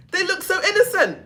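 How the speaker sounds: amused laugh through the words. Real, not acted. Laughing at innocent looks.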